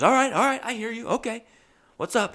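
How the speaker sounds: high-pitched imitation of a placating boss. Boss hearing out an upset customer, placating in a high voice. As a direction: act out the boss, voice pushed well up.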